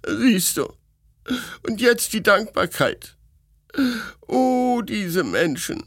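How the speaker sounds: high, wailing complaint; aggrieved princess. A narrator's reading. Princess bewailing ingratitude to Mark, high and wounded.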